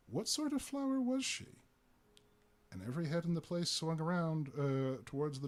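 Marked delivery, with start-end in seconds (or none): 0.1-1.6 a woman's clear sweet voice, pitched much higher; clear sweet contralto. A narrator's reading. The new lady asks the bar, clear sweet contralto. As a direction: voice the lady's clear, sweet question. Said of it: "sweet contralto voice asked".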